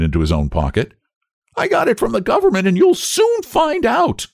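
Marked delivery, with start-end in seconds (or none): 1.6-4.3 very high treble, rising to a shout; defiant boy. A narrator's reading. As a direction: retort hotly, slowing on the threat. Benjamin defiantly answers the colonel.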